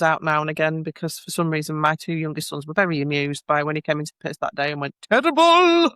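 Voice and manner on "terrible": high, loud drawn-out exclamation like a teacher; mimicked teacherly outburst, pitched way up; Albon as a teacher. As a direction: call it out long and loud like a teacher. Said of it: "like a teacher".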